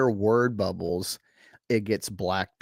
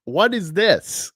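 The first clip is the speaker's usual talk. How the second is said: puzzled; 8 semitones higher, 5 dB louder, slower than usual. Retold or imitated.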